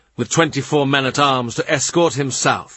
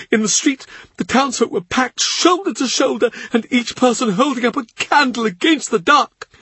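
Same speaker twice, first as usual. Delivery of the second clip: much higher grieving servant's voice; tearful Cavendish; 11 semitones higher than usual. A narrator's reading. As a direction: lift it high like a tearful old retainer. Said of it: "He cries as he talks".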